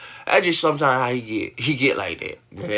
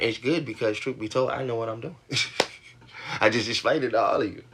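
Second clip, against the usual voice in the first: ordinary talk, nothing marked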